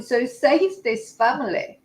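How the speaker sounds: ordinary talk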